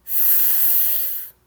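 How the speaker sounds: long voiceless "f" hiss; soft sustained F sound; letter sound F. Shown as a demonstration. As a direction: hold "f" as pure air, no vowel. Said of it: "Let's say it again all together".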